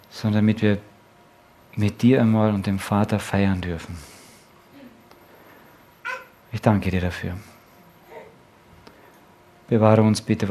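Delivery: low, soft prayer voice sinking to a hush; closing prayer. Acted. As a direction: pray quietly, addressing God directly.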